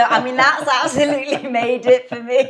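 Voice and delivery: laughing. Real, not acted. Host delighted guest is Geordie.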